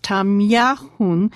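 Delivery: tam-ya-HUN, slow and clear. Shown as a demonstration. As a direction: say it slowly, K like H. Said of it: "The K is pronounced like an H".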